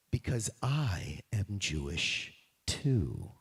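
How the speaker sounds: low and slow confession; slow low admission, voice set apart; solemn reveal. Acted. Esther's big reveal to the king.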